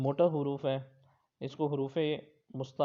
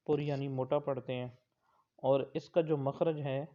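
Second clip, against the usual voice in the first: ordinary talk, nothing marked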